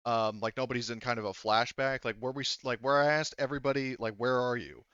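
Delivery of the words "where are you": ordinary talk, nothing marked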